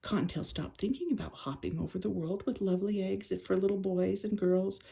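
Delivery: ordinary delivery, nothing marked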